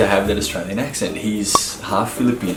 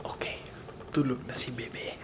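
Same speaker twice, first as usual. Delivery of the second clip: whisper; 3 semitones higher, 13 dB quieter than usual. Real, not acted. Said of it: "keep our voices down".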